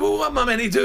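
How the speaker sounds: exasperated. Retold or imitated. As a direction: throw up your hands, high. Bewildered retort to boss.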